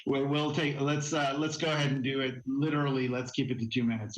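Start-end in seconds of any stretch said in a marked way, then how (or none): none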